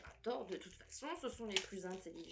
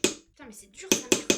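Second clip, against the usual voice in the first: louder and much higher, cursing at the plaster; frustrated outburst; 9 semitones higher, 17 dB louder than usual. Real, not acted. Stuck digging a fossil out of plaster, she explodes in a loud curse. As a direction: let it burst out in frustration.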